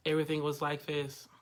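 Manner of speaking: low, quiet deadpan monotone. Retold or imitated. Coach imitates the show Daria to explain pitch, deadpan monotone. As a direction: imitate a bored cartoon teen on one low note. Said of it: "So that monotone".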